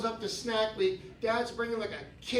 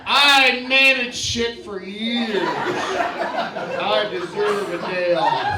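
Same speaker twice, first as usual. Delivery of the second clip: lazy retiree; 7 dB louder, slower than usual. Acted. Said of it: "laying in bed going".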